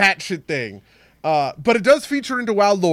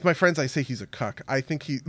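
ordinary talk, nothing marked

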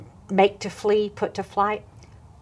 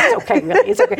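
higher, louder, laughing through it; laughing reassurance; 11 semitones higher, 4 dB louder, faster than usual. Retold or imitated. The speaker tells the mom it's fine, laughing through it. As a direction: reassure her while still laughing.

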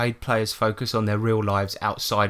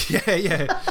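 high, laughing "yeah, yeah"; laughing agreement; 8 semitones higher than usual. Real, not acted. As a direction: agree through real laughter. Laughing at the co-host's impression.

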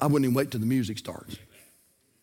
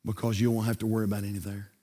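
deadpan punchline; 6 semitones lower, 6 dB quieter than usual. Acted. Punchline delivered slow and low.

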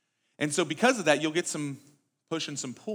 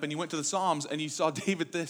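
ordinary delivery

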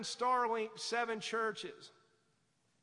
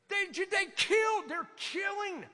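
impassioned cry; 6 semitones higher than usual. Real, not acted. Pastor crying out about martyrs.

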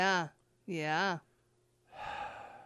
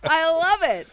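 bursting out with big, loud enthusiasm; loud, gleeful reaction to a groaner; crowing with delight at the pun; loud delighted praise; 6 semitones higher, 12 dB louder than usual. Real, not acted. Host reacts loudly to a joke.

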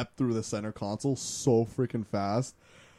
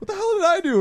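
much higher, louder, confused protest; baffled friend; 5 dB louder than usual. Retold or imitated. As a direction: play the clueless friend, baffled.

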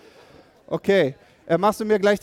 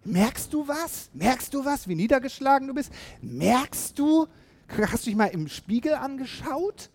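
prodding pep talk; 7 semitones higher than usual. Retold or imitated. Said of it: "Ich sag so".

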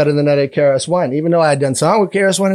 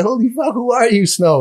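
amazed onlookers; 8 semitones higher than usual. Retold or imitated. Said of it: "Everybody's like".

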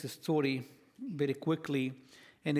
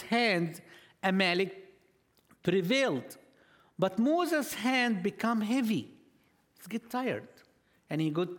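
preaching at full force; 5 semitones higher than usual. Acted. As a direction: drive the story home to the church. Retells Moses story with force.

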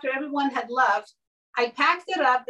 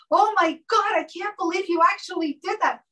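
mock gasp of outrage that fades as the sentence goes on; shocked friend; 7 semitones higher than usual. Friend watching her cake go down the trash chute, in hammed-up shock. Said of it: "all of a sudden she goes".

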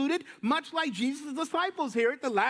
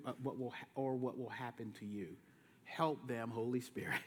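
much lower and softer, resigned; weary Jesus; 10 semitones lower, 10 dB quieter than usual. Retold or imitated. Jesus ending the conversation, low and resigned. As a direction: let it drop, tired and quiet. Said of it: "So Jesus is like".

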